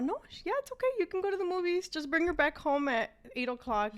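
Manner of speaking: high, bright-pitched mom giving permission; mock excited mother; eager mom. Mom happily agreeing in retold scene.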